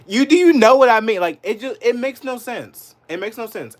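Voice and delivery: high, loud exasperated protest; outraged, pushing the point; exasperated anger. Real, not acted. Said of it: "It makes me so fucking angry".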